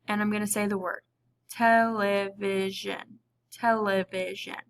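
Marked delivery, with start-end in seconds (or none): 1.5-4.6 television stretched out slowly, syllable by syllable. Shown as a demonstration. Teacher demonstrating to the class, saying it slowly. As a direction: drag out each part of television. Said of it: "You might have to say it slowly".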